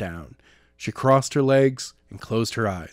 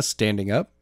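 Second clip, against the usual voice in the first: ordinary delivery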